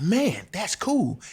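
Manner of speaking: retold wow of approval; impressed reaction. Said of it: "And I was like".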